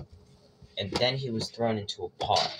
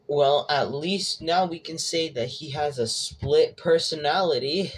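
punchline delivery; 6 semitones higher, 5 dB louder than usual. Pretend. Punchline of a pun.

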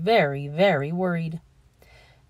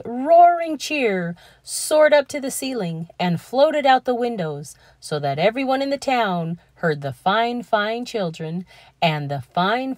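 ordinary delivery, nothing marked

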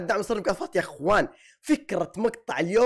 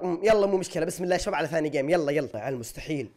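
usual speaking voice

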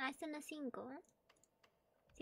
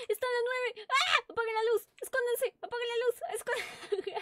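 high, loud yells of alarm; panicked shouting; 10 semitones higher, 10 dB louder than usual. Real, not acted. Player warning the others the monster is at nine, panicked shouting. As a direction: shout frantic orders to hide.

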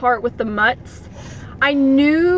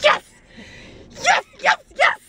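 much higher, repeated cheer; excited cheering. Retold or imitated. Fan cheering the on-screen kiss, excited high yeses. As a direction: celebrate like your ship just kissed. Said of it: "I'm very enthusiastic about this".

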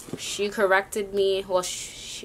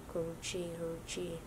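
quiet practice; 13 dB quieter than usual. Real, not acted. Practising the name under breath.

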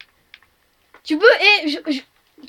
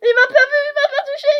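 panicking gamer; 4 semitones higher than usual. Real, not acted. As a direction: squeal as he nearly gets caught. Seeker nearly catches him.

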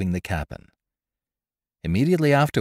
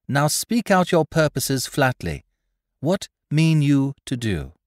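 higher, measured voice with firm authority; commanding; 9 semitones higher, 5 dB louder than usual. A narrator's reading. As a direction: address the crew as the one in charge. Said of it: "quiet command in her voice".

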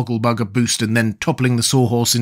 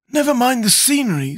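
high-pitched character voice; rally the others in a raised voice; Scarecrow's voice; 13 semitones higher than usual. A narrator's reading. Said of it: "said the Scarecrow".

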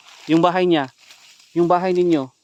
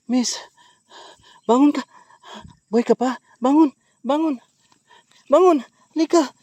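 high, loud repeated call to get up; urgent calling; 8 semitones higher, 5 dB louder than usual. Real, not acted. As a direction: urge her up, louder each time. Urging the woman to get up. (nagmamadaling sigaw, mataas at malakas)

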